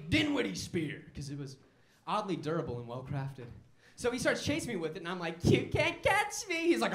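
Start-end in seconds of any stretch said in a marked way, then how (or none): 5.4-6.7 taunting. Retold or imitated. Taunting friend while fleeing.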